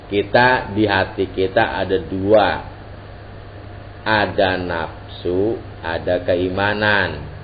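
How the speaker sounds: slow, deliberate explanation with long pauses. Shown as a demonstration. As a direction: lay it out word by word, slowly. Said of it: "Coba diulang, jangan cepet-cepet ngomongnya". (pelan-pelan, tidak terburu-buru)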